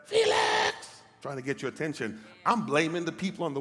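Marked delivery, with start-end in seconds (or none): 0.1-1.0 high breathy call of a name; God calling out. Acted. God calling the preacher after a job loss, breathy high call.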